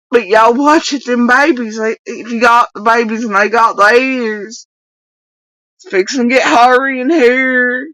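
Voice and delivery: high, loud country twang; mocking Kentucky doofus accent. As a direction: imitate her in a hammed-up hillbilly accent. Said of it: "doofus accent".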